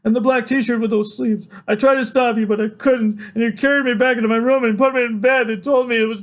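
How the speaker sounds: high, loud, urgent recounting; agitated outpouring. A narrator's reading. Son proving his memory to father, agitated high outpouring. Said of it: "talking so fast it makes me shake".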